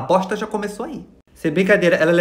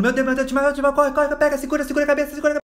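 high, rushed mock panic; panicked bystanders; 7 semitones higher than usual. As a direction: imitate a crowd flapping in alarm, high-pitched. Crowd panics at the faked faint.

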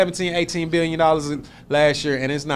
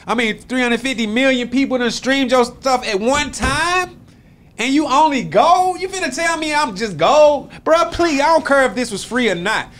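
incredulous, much higher-pitched protest; indignant disbelief; 10 semitones higher than usual. Acted. Artist protesting a gold certification to the label, indignant. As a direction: stretch the words out high in disbelief.

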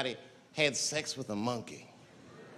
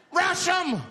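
high rallying yell; acted scream to charge; 11 semitones higher, 4 dB louder than usual. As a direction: shout the order, pitch way up. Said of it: "He screamed out".

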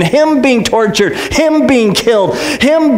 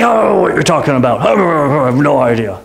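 low, evasive mumbling Peter; hammed-up bluffing denial; nervous denial; 10 semitones lower than usual. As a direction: play dumb, dropping the pitch right down. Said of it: "he's freaking out a little bit".

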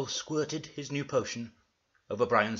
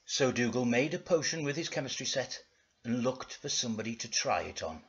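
ordinary talk, nothing marked

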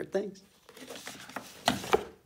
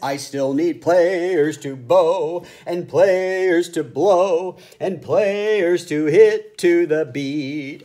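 loud, slow, urgent call; louder conductor; 4 semitones higher, 8 dB louder, much slower than usual. A narrator's reading.

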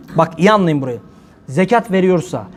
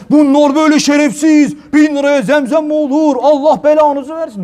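mock angry critic; 7 semitones higher than usual. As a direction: rage at the preacher like a hypocrite. Imitating critics attacking the preacher.

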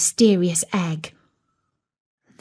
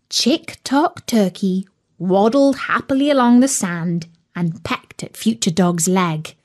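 ordinary delivery, nothing marked

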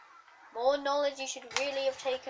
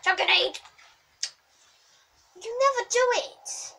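high, loud complaint; exasperated; 7 semitones higher, 5 dB louder than usual. Real, not acted. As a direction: yell it at the game, fed up. Venting at the game while playing.

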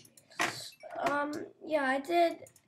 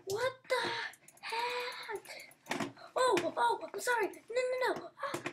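panicked; 9 semitones higher, slower than usual. Real, not acted. Chased by tiger, panicking.

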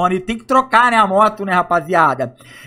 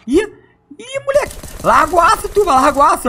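excited; 10 semitones higher than usual. Real, not acted. Zombie threatens downed character.

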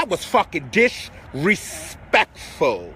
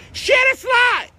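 hype call; 12 semitones higher, 3 dB louder than usual. Real, not acted. Hyping viewers to share live.

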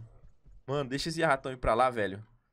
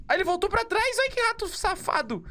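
startled; 4 dB louder than usual. Real, not acted. Rat turns back.

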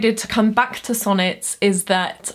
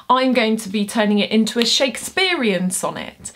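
ordinary talk, nothing marked

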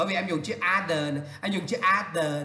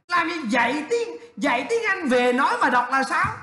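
raised pitch, exasperated teacher; incredulous objection; 6 semitones higher, 4 dB louder than usual. Real, not acted. Teacher questioning a student's odd wording, incredulously. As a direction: challenge the phrase as if it makes no sense.

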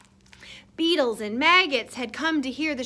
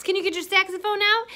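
plain reading voice

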